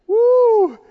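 a high, loud, laughing woo; laughing whoop. Real, not acted. Preacher delighted by the soldiers' loyalty, laughing whoop. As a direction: let a whoop of delight burst out.

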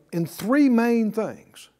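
ordinary talk, nothing marked

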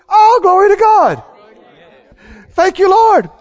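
high, loud burst of glory to God; a shouted thank you to the Lord; joyful praise shout. Real, not acted.

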